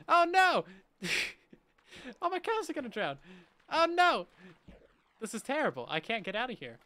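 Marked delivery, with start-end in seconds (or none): 0.1-0.7 high, loud alarmed "oh no"; real panic as the cows start drowning; panicked cry. Real, not acted. Streamer sees his cows about to drown, panicked loud cry. 2.2-4.3 high, loud alarmed "oh no"; real panic as the cows start drowning; panicked cry. Real, not acted. Streamer sees his cows about to drown, panicked loud cry.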